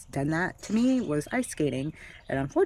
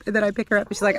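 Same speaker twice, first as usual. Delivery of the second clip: ordinary talk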